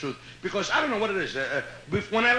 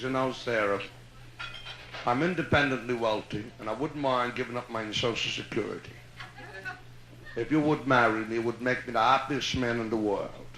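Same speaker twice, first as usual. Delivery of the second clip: put-on low, gentle Yiddish-accented old man; lightly voiced old man proposing in a Yiddish accent; Yiddish-accented proposal; 10 semitones lower, 4 dB quieter than usual. Old man proposes to Sarah, low Yiddish accent.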